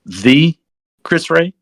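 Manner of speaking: THE set apart, then Chris Ray rising; double-check "The Chris Ray" in disbelief, pausing after The; retold amazement. Team guy hearing a famous navigator's name, incredulous.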